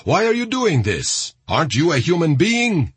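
high, outraged questioning; insulting challenge. A narrator's reading. Saudi soldier insulting the ringleader, outraged. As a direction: challenge his humanity with pitch climbing. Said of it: "insulting him".

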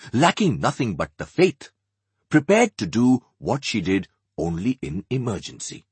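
plain reading voice

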